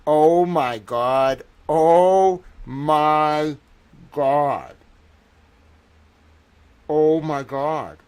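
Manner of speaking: drawn-out, loud disbelief; slowly letting it sink in, overwhelmed; stunned oh my God. Real, not acted. Overwhelmed at his own find.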